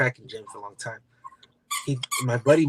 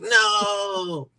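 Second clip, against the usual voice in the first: shouting; 8 semitones higher than usual. Retold or imitated. Retold reaction to friend's news.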